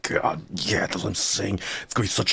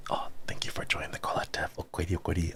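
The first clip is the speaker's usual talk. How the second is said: whispering; 8 dB quieter, faster than usual. Real, not acted. Thanking a subscriber, whispered.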